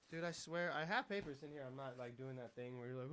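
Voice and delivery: usual speaking voice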